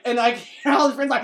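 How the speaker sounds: ordinary talk, nothing marked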